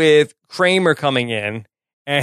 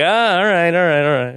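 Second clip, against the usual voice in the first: mock crowd-pleaser; 2 semitones higher than usual. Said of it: "They're like".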